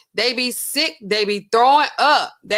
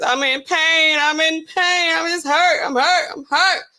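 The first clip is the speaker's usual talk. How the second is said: imitating a crying man, higher and louder; mock crying plea; 7 semitones higher, 4 dB louder, slower than usual. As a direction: whine I'm in pain like a sobbing addict. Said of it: "Then he just cry".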